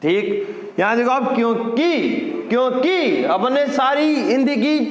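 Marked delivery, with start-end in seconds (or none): 1.4-3.2 क्योंकि with a stressed, stretched 'kee' ending; long final i mistake. Shown as a demonstration. Teacher showing how people spoil Hindi, stretched final vowel. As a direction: lean on the last syllable of क्योंकि, making it long. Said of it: "ऐसे बोल बोल के".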